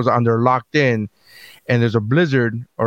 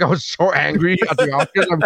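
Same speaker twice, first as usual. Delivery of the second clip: ordinary talk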